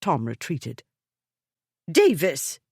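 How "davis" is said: commanding call, louder; voice of authority. A narrator's reading. Lady Exton summoning the maid, commanding call. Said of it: "The voice of authority".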